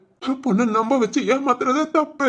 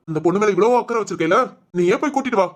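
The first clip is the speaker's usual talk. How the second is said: ordinary talk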